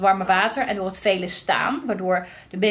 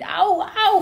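mock ouch; 9 semitones higher than usual. Shown as a demonstration. Demonstrating pain reaction.

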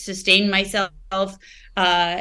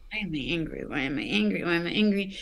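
low, hushed self-questioning; retold private muttering, much softer and lower; muttering to herself; 3 semitones lower, 9 dB quieter, faster than usual. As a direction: repeat it under your breath while puzzling it out. Retelling groggy thinking to herself.

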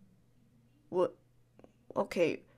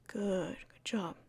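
soft, low whispered good job; whispered praise; 3 semitones lower, 7 dB quieter, slower than usual. Pretend. Whispering praise to a calmed friend. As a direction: praise them gently, barely voiced.

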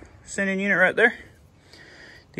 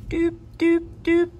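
high sing-song doop sounds; imitated beeping; slower than usual. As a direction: chirp out little doops. Mimicking a sound on the pump.

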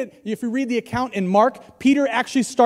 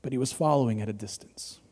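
hushed refrain; 8 semitones lower, 10 dB quieter than usual. Real, not acted. Somber refrain, first take.

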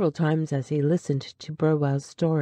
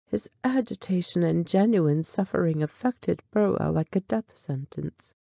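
ordinary delivery

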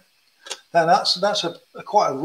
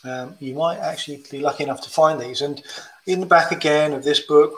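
ordinary talk

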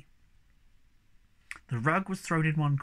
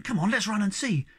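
eager, higher-pitched urging; excited cry; 6 semitones higher than usual. A narrator's reading. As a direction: hurry them along excitedly. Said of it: "cried Snufkin".

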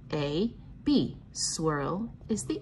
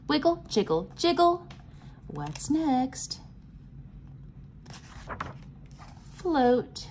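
lively sing-song read-aloud, chanted refrains higher; reading to children; 6 semitones higher, slower than usual. Acted. Teacher reading a pattern book to the class, bouncy sing-song chant. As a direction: chant the refrains like a nursery rhyme for little ones.

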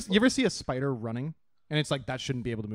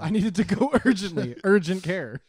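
higher, louder teasing repeat through laughter; mocking laugh; 6 semitones higher, 6 dB louder than usual. Mocking S0 about urgent thumb, laughing. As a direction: tease his wording.

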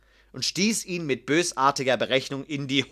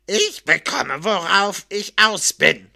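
slow, heavy threat from a guard; menacing; 3 semitones higher, 5 dB louder, slower than usual. A narrator's reading. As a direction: spell out the threat in a put-on guard's voice. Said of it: "sagte der Wächter drohend". (langsam und drohend)

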